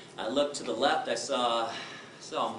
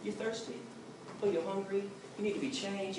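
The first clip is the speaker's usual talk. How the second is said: put-on soft baby talk; 5 semitones higher, 6 dB quieter than usual. Parent guessing crying baby's needs.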